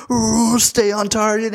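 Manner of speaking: high excited pilot radio voice; mock fighter pilot. Fly acting like an ace pilot dodging into the car, high and cocky.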